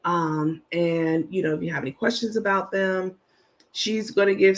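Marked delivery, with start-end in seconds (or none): none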